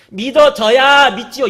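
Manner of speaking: drawn-out imitation of a skeptic; mock objection. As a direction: parody the doubter's excuse. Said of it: "이, 이 말 하지 마세요".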